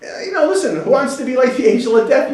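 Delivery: high-pitched, hedging voice; reluctant volunteer. Retold or imitated. Reluctant angel candidate answering God, high and hedging. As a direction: beg off in a high, incredulous voice.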